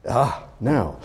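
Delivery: ordinary talk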